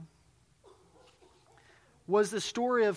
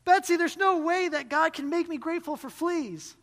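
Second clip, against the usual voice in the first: exasperated; 9 semitones higher, 6 dB louder than usual. A narrator's reading. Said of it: "this was too much".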